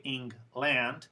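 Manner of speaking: "England" as ING-LAND with a full a; slow "England", second syllable unreduced like "land"; no schwa. Shown as a demonstration. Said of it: "you will sound a little less fluent".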